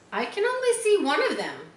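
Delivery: high, quick piglet voice; voiced pig. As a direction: up high and fast like a small pig. Wilbur's line, read aloud.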